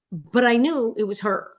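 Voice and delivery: ordinary talk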